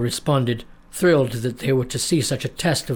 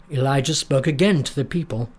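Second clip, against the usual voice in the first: usual speaking voice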